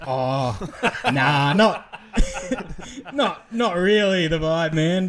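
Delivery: slow uneasy brush-off; mock awkward refusal. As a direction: stretch it out, cringing. Telling him not to smoke inside.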